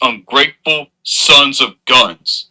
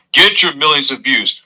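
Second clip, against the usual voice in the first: ordinary talk, nothing marked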